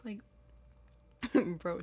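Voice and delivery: normal talking voice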